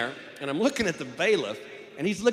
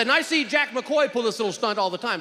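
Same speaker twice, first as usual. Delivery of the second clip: excited; 8 semitones higher, 4 dB louder than usual. Retold or imitated. Overexcited juror rant to lawyer.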